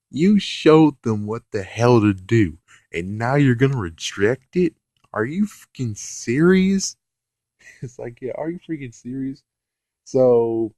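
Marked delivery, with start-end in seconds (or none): 5.1-7.0 outraged. Real, not acted. Outraged at the Prism.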